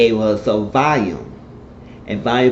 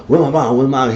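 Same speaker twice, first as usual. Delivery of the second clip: loud urgent repeated question; raised frantic where my mom; frantic demand; 5 semitones higher, 7 dB louder, faster than usual. Retold or imitated. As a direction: storm in calling for mom. Betty bursting in panicked.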